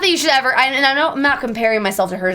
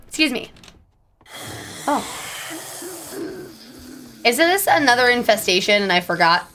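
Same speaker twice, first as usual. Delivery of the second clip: ordinary talk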